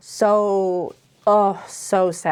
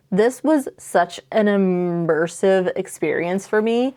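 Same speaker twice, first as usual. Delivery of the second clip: normal talking voice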